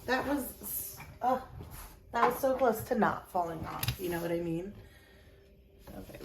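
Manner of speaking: ordinary talk, nothing marked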